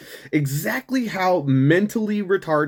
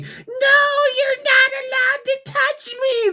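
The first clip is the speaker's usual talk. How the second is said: much higher, loud shrieking protest; mock scream; 3 dB louder than usual. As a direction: shriek it like a hysterical arrestee.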